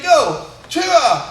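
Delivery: loud, high karate yell; kiai shout. Shown as a demonstration. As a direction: call the move and punch out the kiai. Leading the kiai drill.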